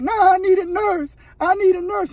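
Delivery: high, pleading whine of a sick prisoner; whiny begging inmate. Retold or imitated. Sick inmate begging the captain for a nurse, high whiny plea. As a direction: beg for the nurse like a hurting kid.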